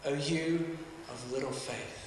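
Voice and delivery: low, hushed reply; Jesus gently rebuking. Acted. Jesus answers sinking Peter, low and very soft. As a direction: drop right down, soft and low. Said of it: "what does he say to him?".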